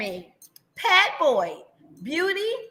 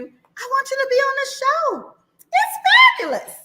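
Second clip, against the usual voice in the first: excited; 9 semitones higher, 4 dB louder than usual. Real, not acted. Host gushing about show.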